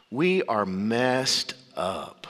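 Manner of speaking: slow, low and heavy; grave slow pronouncement. Shown as a demonstration. Preacher stating the second truth, slow and grave. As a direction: weigh every word down. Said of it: "The second one".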